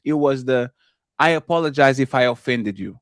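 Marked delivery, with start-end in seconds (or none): 1.2-3.0 low, dismissive fake apology; insincere apology. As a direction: say sorry without meaning it. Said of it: "while you laughing".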